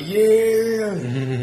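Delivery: high, loud cheering yell with arms up; mock cheer. Mocking his friend's cheer at the gate, high loud whoop. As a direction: whoop it out like you just got in.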